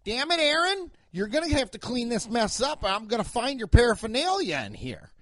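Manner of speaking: high, fussy mock-dad voice; hammed-up upset dad; scolding dad. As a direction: scold your son like an exasperated father. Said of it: "guy's dad comes in and he's like".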